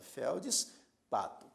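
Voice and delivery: "feldspato" slowly split, felds held apart from pato; syllable by syllable. Shown as a demonstration. Teacher confirming the syllable division, "feldspato" broken slowly after felds. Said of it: "na mesma sílaba".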